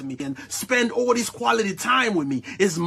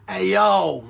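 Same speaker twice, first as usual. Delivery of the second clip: ordinary talk, nothing marked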